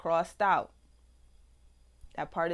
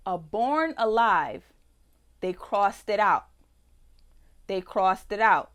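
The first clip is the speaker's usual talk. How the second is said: indignant emphasis; 6 dB louder than usual. Real, not acted. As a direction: hammer home the outrage. Outraged emphasis on struck text.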